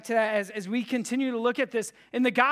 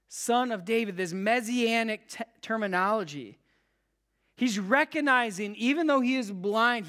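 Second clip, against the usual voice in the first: ordinary talk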